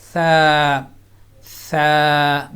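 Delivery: light thin ثا with no whistle; ثا said light and soft, never like سا; soft thin tha. Shown as a demonstration. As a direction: say ثا soft, no hissing. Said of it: "بالکل soft آواز میں".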